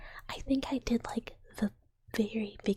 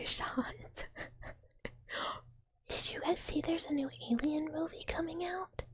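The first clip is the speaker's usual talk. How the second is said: ordinary talk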